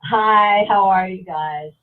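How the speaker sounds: loud, drawn-out hello to everyone; shout-out greeting. Real, not acted. Guest greets the show's viewers when asked, loud shout-out. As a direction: call out a big long "hi", then ease off. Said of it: "Give us a little shout out".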